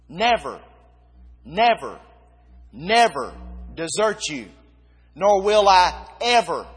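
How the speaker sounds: each NEVER and EVER struck hard, with pauses between; emphatic repetition. Shown as a demonstration. Preacher showing the congregation the five negatives, emphatic, spaced NEVER and EVER. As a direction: hammer every "never" and "ever" as its own falling blow. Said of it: "how that reads is like this".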